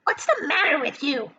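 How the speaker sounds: a hint of a screeching aunt; mild hag screech; Aunt Spiker screeching. A narrator's reading. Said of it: "Aunt Spiker screeched".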